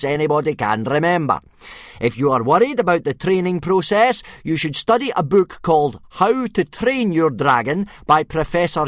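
gruff teacher addressing his recruits; booming Viking teacher. A narrator's reading. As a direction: lecture the recruits like a drill master. Gobber's voice held.

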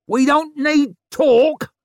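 slow, high, loud howl. A narrator's reading. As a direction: howl it at the speaker. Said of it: "she howled".

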